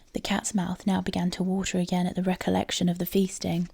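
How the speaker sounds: ordinary delivery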